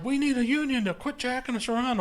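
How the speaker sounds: lightly voiced union worker; mock worker demand. Retold demand.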